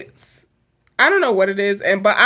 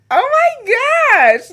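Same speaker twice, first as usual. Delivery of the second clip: excited gasp; 7 dB louder, much faster than usual. Retold or imitated. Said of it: "I just felt like".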